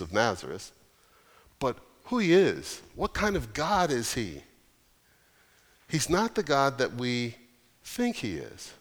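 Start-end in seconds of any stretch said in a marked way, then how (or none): none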